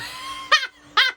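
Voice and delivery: a high, loud burst of laughter; startled, delighted laughing shriek. Real, not acted. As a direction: squeal with surprised laughter. Bursts out at booty tingles.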